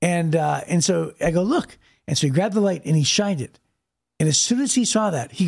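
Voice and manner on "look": high, pleading look; urgent plea. Retold or imitated. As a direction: point him to the proof, urgently. Pointing officer to car sticker.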